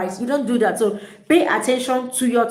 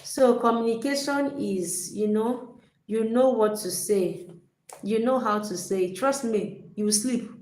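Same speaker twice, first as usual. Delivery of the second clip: much softer, sleepy lecturer monotone; dull, low-energy talk; 6 dB quieter than usual. Shown as a demonstration. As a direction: drone on like a boring speaker who puts people to sleep. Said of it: "Imagine I came here to talk about communication and I'm like".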